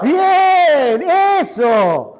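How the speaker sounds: loud, high approving shout; excited cheer. Real, not acted. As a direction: cheer the congregation on. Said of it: "pastor cheers the shout". (¡bien! gritado con entusiasmo)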